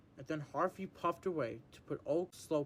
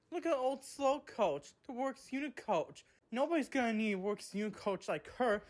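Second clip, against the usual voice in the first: mocking tease; 6 semitones higher than usual. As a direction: taunt her from the side of the line. James teasing the coach.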